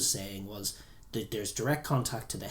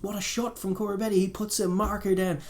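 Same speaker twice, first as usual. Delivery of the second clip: mock commentator call, pitched way up; 9 semitones higher than usual. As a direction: ham up an excited commentator.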